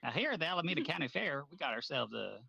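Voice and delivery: put-on loud old-timey fairground barker; carny photographer. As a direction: hype up the county fair crowd. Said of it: "like a carny photographer from the nineteen thirties".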